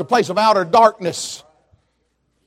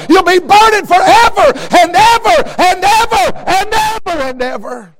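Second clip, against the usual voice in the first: higher, shouted fiery preaching; preaching at full force; 6 semitones higher than usual. Real, not acted. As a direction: drive the warning home, building.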